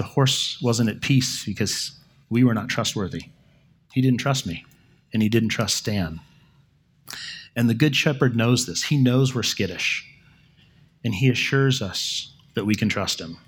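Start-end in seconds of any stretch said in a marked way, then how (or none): none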